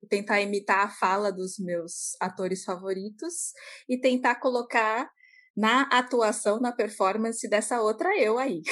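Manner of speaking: ordinary talk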